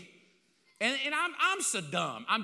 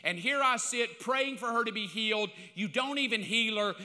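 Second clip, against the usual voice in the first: ordinary talk, nothing marked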